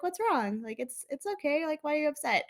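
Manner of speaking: high, gentle mock-mom comforting; sweet motherly talk. Mom in the doorway to her crying baby, sweetly imitated. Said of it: "talking sweetly to me".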